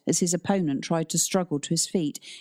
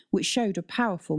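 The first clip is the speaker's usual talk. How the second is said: usual speaking voice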